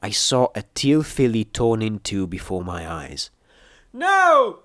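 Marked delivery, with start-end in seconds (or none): 3.9-4.6 long, very high, loud cry; anguished bellow. A narrator's reading. Seeing companion about to die, anguished bellow. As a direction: wail a desperate "No!". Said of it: "I bellowed".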